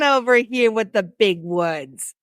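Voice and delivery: a hammed-up New York and Philly accent; sudden New York accent. Teasing her co-host in a mock New York accent. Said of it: "I don't know where that accent came from".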